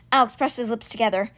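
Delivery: ordinary talk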